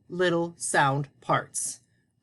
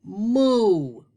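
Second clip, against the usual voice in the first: higher, louder puppet moo; mooing like a cow; 5 semitones higher, 6 dB louder than usual. Pretend. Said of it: "he's saying moo like a cow".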